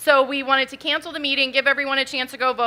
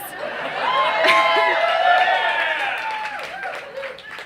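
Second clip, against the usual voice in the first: high burst of laughter; crowd-pleasing laugh; big laugh; 3 dB quieter than usual. Real, not acted. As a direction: laugh out at the comeback. Laughing at her own comeback.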